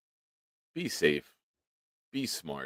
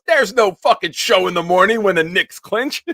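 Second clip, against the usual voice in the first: mock rant; 14 semitones higher, 9 dB louder than usual. [name] berating the caller.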